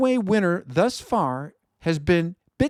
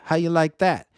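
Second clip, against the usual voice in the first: usual speaking voice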